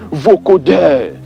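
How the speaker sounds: ordinary talk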